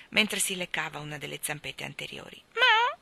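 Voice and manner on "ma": short high, loud meow; cat meow. A narrator's reading. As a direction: mew like a nonchalant cat. Cat's meow misheard as a word. (miagolio acuto)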